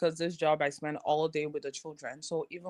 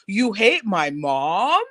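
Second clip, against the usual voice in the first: slow, louder accusing question; imitating the boy; 9 dB louder, much slower than usual. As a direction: drag it out like a kid accusing. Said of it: "he goes".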